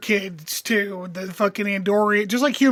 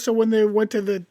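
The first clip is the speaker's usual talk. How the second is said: usual speaking voice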